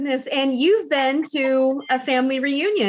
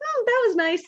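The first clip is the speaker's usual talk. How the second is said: lightly voiced shrug, high; a casual, unmoved "oh, that was nice"; mild mock understatement; 7 semitones higher, much faster than usual. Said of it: "or are you just".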